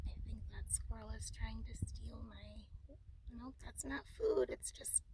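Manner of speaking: soft early-morning whisper; hushed, keeping the voice down. Real, not acted. Hushed aside about squirrel.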